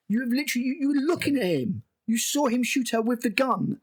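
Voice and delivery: high, exasperated insistence; incredulous protest. Real, not acted. Reviewer telling Wendy she saw it happen, incredulous. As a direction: argue back in disbelief, pitched high. Arguing with the character.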